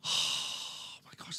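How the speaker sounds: airy, slow, hushed "Oh my gosh"; breathy mock gasp. Pastor mocking a starry-eyed new believer, breathy whisper.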